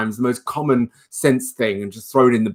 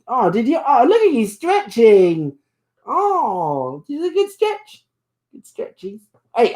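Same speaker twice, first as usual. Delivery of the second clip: much higher sing-song pet talk; 11 semitones higher, slower than usual. Real, not acted. Owner praising her stretching bunny, high cooing baby talk.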